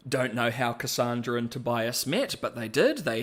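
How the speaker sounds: ordinary talk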